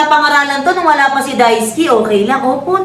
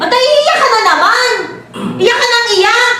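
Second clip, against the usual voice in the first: high exasperated teasing; teasing scold; 11 semitones higher than usual. Real, not acted. Friend teasing the crying dancer, high exasperated scold. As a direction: needle her for crying.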